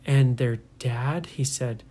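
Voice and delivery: plain reading voice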